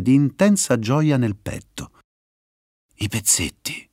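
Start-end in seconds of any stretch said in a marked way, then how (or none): 3.0-3.9 breathy whisper to herself; whispered thought. Acted. Viperella thinking to herself, a soft whisper. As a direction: realise it under your breath.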